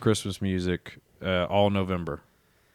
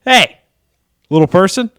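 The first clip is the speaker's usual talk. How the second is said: loud, high-pitched mock greeting; big, high call-out; 8 semitones higher, 9 dB louder than usual. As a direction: holler a greeting down at someone. Greeting the little person down there.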